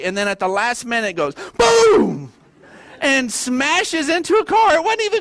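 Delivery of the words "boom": crash sound shouted high and loud; loud high boom. Retold or imitated. Pastor shouting the crash sound to the congregation.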